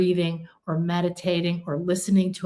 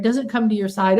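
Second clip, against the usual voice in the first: ordinary delivery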